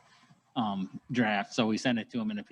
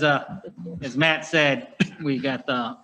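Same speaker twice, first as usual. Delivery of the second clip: normal talking voice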